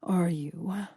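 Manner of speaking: velvety tone. Acted. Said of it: "he asked in that same velvety tone".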